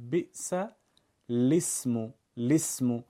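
بئس الاسم said with an i sound added, as بئسِ لِسم; linked with kasra. Shown as a demonstration. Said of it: "یک کسره به حرف ساکن اول اضافه کنید".

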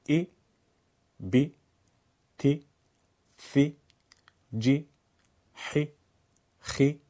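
each letter with a short Arabic i; Arabic letter drill; Arabic kasra letters. Shown as a demonstration. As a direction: chant "ای، بی، تی" with an Arabic accent.

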